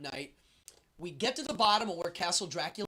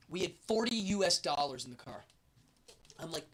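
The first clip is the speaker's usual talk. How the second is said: ordinary talk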